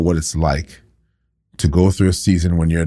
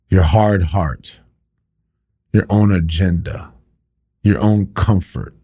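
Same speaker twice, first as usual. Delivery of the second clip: healed man confronting his doubters, passionate preaching; preaching at full force. Acted. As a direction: drive it home to the congregation. Preaching climax.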